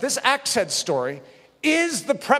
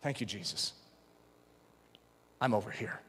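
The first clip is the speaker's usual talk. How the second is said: quiet surrender; 10 semitones lower, 12 dB quieter than usual. Retold or imitated. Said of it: "hear the request".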